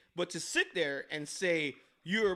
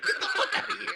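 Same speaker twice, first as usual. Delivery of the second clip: laughing. Retold or imitated. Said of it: "And I'm like".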